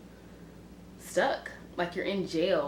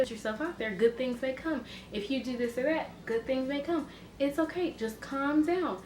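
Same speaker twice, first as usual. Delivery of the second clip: gentle angel voice; 7 semitones higher than usual. Retold or imitated. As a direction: coax sweetly like a kind angel on your shoulder. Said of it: "the nice angel".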